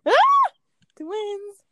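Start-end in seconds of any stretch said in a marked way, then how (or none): 0.1-0.5 short, very high loud shriek; sudden squeal. Real, not acted. Friend goofing around while planning a podcast, sudden squeal. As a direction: let out a piercing little scream.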